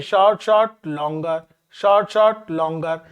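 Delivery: group "short, short, longer" in repeated threes; short-short-long rhythm. Shown as a demonstration. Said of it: "आप क्रम से ऐसा लगाएं".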